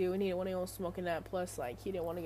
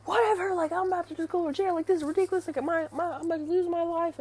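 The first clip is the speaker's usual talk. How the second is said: loud, high-pitched mock rant; retold indignant yelling, louder and higher; imitated livid outburst; 9 semitones higher, 6 dB louder, faster than usual. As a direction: mimic a ranting meltdown, pitched way up. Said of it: "He was livid".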